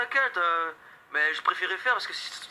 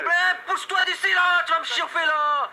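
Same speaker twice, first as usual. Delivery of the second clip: high angry yell; angry shouting; 10 semitones higher, 4 dB louder, slower than usual. Acted. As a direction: order him off the road. Yelling at man blocking road.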